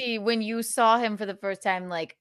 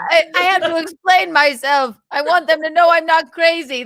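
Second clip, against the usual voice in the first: mock frantic; 7 semitones higher, 7 dB louder than usual. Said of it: "I was like".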